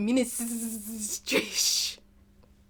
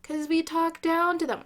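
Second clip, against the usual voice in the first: mock clueless Democrat; 6 semitones higher, 4 dB louder than usual. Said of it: "and then I go like".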